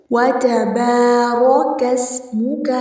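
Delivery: the س in اسمک hissed sharply like a whistle; whistled seen. Shown as a demonstration. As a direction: lean on a crisp, whistling س in اسمک. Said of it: "سین کو سیٹی سے ادا کریں گے".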